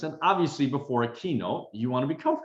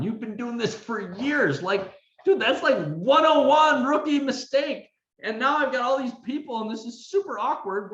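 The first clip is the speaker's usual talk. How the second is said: much higher, scolding self-mockery; mocking inner critic; 13 semitones higher, 4 dB louder than usual. Speaker voicing his inner critic after the hot mic, high and scolding. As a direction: scold yourself in a high nagging voice. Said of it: "imagine what my inner critic was saying".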